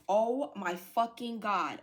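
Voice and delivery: loud, drawn-out disbelief; floored, slow and emphatic; stunned exclamation. Real, not acted. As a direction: stretch out each word in real shock. Stunned by the card she reads.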